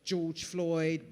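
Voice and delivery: ordinary talk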